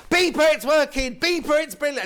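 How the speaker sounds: high, loud cry of joy down the phone; overjoyed yell of thanks; excited shout. Retold or imitated. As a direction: shout your relief at the helpdesk lady. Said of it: "I'm so used to being shouted at".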